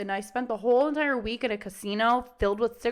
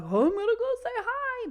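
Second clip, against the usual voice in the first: much higher, eager dog voice; 12 semitones higher than usual. Retold or imitated. Reactive dog spotting another dog, much higher and eager. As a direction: voice the dog bounding toward a friend.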